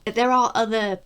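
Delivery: ordinary talk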